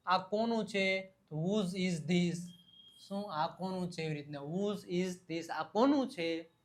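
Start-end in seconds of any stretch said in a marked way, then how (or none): 1.3-2.4 each word drawn out slowly for learners; slowly modelled. Shown as a demonstration. Teacher modelling whose is this slowly. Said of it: "teacher models English phrase". 4.4-5.5 each word drawn out slowly for learners; slowly modelled. Shown as a demonstration. Teacher modelling whose is this slowly. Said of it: "teacher models English phrase".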